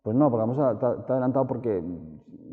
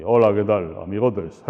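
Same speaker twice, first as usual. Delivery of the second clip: much louder, hearty guy in a bar; loud bar greeting; 8 dB louder than usual. Shown as a demonstration. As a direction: boom out a greeting to your mates. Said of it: "entro en el bar y voy hablando así".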